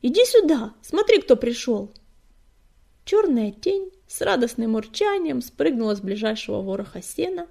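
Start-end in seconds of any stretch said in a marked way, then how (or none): 0.0-1.9 loud, raised summons; calling out. A narrator's reading. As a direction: call a friend out of the dark. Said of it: "позвал он в темноту".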